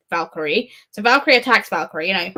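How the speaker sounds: ordinary talk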